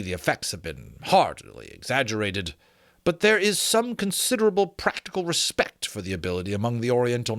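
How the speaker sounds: measured, formal read-aloud narration; story narration. Acted. Reading an old dragon-lore excerpt to listeners, measured narration. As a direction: read it like a learned old treatise.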